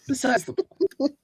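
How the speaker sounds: very high, strained squeak; sudden comic jump into a high voice. Pretend. Squeaked, then said over normally.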